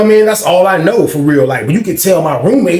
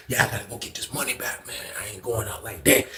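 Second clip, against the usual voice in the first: muttering to himself; 4 semitones lower, 15 dB quieter than usual. Retold or imitated. Voicing roommate's inner thoughts.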